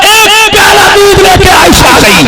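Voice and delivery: very high preacher's cry; triumphant shout. Real, not acted. Preacher revealing Aisha's cup of milk, triumphant high cry. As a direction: shout the reveal to the whole crowd.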